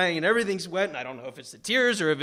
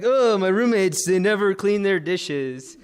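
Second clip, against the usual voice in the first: mock complaint; 2 semitones higher, 4 dB louder than usual. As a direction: moan it as a pretend big problem. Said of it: "pretend twenty-year-old problems".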